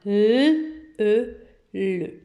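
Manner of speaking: L, e, le, blended clearly; sounding out. Shown as a demonstration. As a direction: build the syllable le step by step. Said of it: "Très bien". (décomposer puis lire le)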